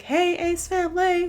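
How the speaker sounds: high bubbly intro greeting; mock YouTuber hello; imitating vlog intro. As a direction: chirp it like a vlogger greeting fans. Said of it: "Catherine's like".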